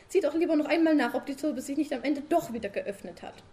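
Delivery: high, loud wife pestering her sleepy husband; nagging wife. Acted. Frau Sumsum als Figur gesprochen. (hohe, laute nörgelnde Ehefrau)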